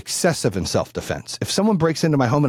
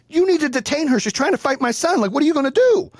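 much higher-pitched, agitated plea; frantic demand; 13 semitones higher than usual. Retold or imitated. Parent demands the cop detain her, frantic. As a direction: re-enact demanding that someone step in.

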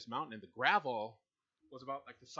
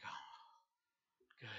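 retold whispered relief; 12 dB quieter than usual. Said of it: "And I'm like".

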